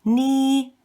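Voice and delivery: "ni" held high, straight and long; long, level, high-pitched "ni"; first tone. Shown as a demonstration. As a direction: sustain "ni" on one high level note. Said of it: "Let's do them all together again".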